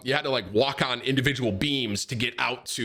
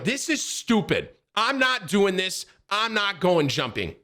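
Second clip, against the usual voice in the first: higher-pitched, annoyed protest; pissed-off refusal; 8 semitones higher than usual. Retold or imitated. Fed up with friends dragging him along, pissed-off refusal. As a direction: refuse in a raised, irritated voice. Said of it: "I'm so pissed at them".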